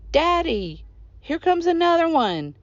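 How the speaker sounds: put-on boy calling out. A narrator's reading. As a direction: call out a warning across the yard. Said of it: "the boy called".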